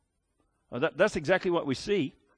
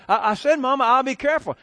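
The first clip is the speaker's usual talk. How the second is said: put-on higher, louder whiny little boy; insistent child; 8 semitones higher, 7 dB louder than usual. Boy insisting to his mother, higher and louder. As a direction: plead like a stubborn five-year-old.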